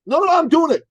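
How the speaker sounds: put-on high, naive young guy; eager trainer voice. Dan telling his coach about doctors, high naive voice. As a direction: voice him high and earnest.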